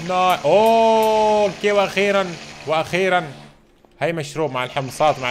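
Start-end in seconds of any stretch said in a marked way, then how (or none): 0.1-3.3 long, drawn-out, high and loud "oh"; overjoyed cry. Real, not acted. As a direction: stretch the cry out in real delight. Gas finally arrives, relief.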